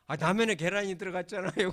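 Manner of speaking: a loud mock of someone crying over egg in ramen, 라면에 pressed hardest; tearful gratitude. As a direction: play the weeper, loud and half-hearted. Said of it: "그러면서 울고".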